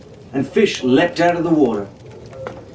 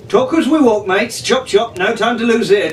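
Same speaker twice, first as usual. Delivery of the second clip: brisk hurry; 8 semitones higher than usual. Acted. Jack hurrying them through jungle.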